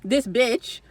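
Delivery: ordinary delivery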